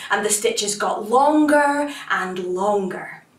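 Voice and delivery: ordinary delivery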